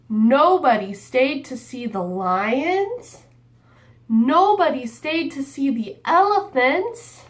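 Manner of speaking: lively picture book read-aloud; reading to children. A narrator's reading. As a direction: read it like storytime for little kids.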